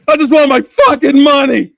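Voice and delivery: high, loud, fed-up tough-guy voice; impatient Scott. Retold or imitated. Acting out Scott's character demanding his money, angry and loud. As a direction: snap it out like a greedy thug. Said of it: "you got Scott over here going".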